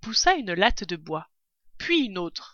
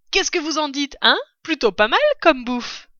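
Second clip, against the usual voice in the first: excited; 6 semitones higher, 5 dB louder than usual. Acted. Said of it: "s'écria Maître Renard, sautant et dansant".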